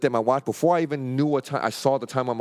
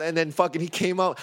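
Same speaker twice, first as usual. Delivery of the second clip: usual speaking voice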